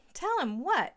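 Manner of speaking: feigned innocence; innocently. A narrator's reading. Tammy asks the crying stepmother, faking innocence. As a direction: play it dumb and sweet. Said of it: "asked Tammy innocently".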